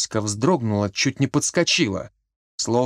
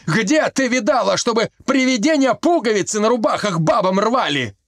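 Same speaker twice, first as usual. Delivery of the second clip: yelling; 8 semitones higher than usual. A narrator's reading. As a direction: keep yelling at her. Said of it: "начал орать Колька".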